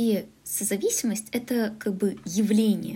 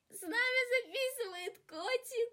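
very high, much softer cooing; 9 dB quieter than usual. Real, not acted. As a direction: coo it more gently, stress нами. Repeat softer to cat.

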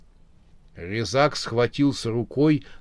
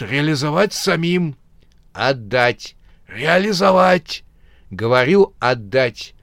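stubborn back-and-forth, higher; mock quarrel; 5 semitones higher, 3 dB louder than usual. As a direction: bicker like squabbling relatives. Uncle and nephew quarrel back and forth.

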